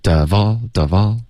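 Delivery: "of all" joined, f sliding into "all"; linked reading. Shown as a demonstration. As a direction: say "of all" as one linked word. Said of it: "最好连读". ("of all" 两词连读)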